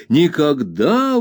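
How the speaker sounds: slow emphatic cry of never; exclaiming. A narrator's reading. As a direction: plant the word firmly and slowly. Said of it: "воскликнула Пегати".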